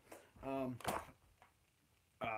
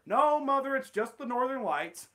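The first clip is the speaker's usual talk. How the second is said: higher, louder quick answer; Skinner reassuring Mother; 7 semitones higher, 6 dB louder than usual. Acted. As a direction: brush off Mother. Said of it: "Steamed Ham script".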